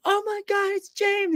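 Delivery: very high starstruck squeal; squealing fan. Retold or imitated. Fan spotting James at the deli, squealing.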